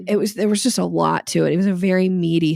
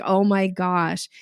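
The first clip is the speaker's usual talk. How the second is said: slow, stretched oh my gosh; a light retold freak-out; drawn-out mock panic; slower than usual. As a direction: let the worry stretch the words. Waking up sweating, retold panic.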